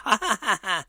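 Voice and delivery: high staccato "ha ha ha"; mimicked high-pitched laugh; imitated laughter. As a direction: cackle in quick high bursts. Retold reaction to his joke.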